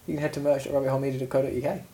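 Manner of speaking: mock advert voice for the pillow merch; ad read. Reads merch plug.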